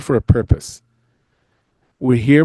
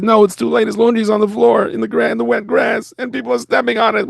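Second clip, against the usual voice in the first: put-on high-pitched whining kid; upset boy; 10 semitones higher than usual. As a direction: whine it like a distraught camper. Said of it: "And he said".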